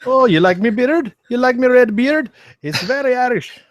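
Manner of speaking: very high mock Irish accent. Shown as a demonstration. Co-host taking his turn at the accent challenge, high Irish accent. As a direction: pitch it right up into playful Irish. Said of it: "Turf, how about you?".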